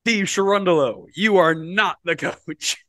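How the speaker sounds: mock show announcer. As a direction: draw out a pretend verdict for suspense. Mock reality-show firing announcement.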